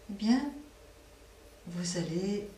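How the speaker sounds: normal talking voice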